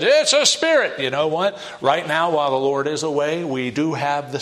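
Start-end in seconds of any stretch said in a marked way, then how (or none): 0.0-1.0 high, loud acted outcry; fearful cry. Disciples mistaking the Lord for a spirit, fearful cry. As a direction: blurt it out in mock alarm. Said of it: "when they said".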